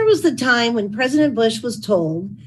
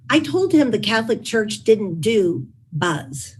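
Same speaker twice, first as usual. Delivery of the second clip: ordinary talk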